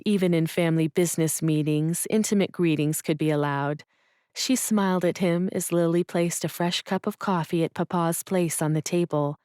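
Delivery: ordinary delivery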